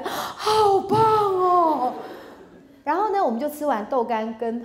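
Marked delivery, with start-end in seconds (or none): 0.0-1.9 very slow, high, loud coo with a laugh; drawn-out gushing praise. Retold or imitated. Wife gushing over husband's plan, slow and high. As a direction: stretch the praise out adoringly.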